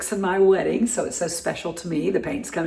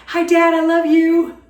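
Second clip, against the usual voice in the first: loving shout-out; 10 semitones higher, 8 dB louder than usual. Real, not acted. Calling out to her dad.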